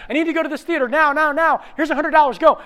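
high, loud, pressed demand; frantic urging. Acted. Late actor to cab driver, frantic. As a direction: hurry the driver along loudly.

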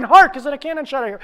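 high, loud, rapid rehearsal; frantic shouted line. Acted. Late actor rehearsing on the dash, frantic shout. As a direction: shout it on the run.

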